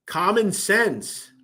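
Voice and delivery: very slow, each word weighted; slow emphatic slogan. Acted. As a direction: lay down the motto, drawn out. Catchphrase slogan of his show.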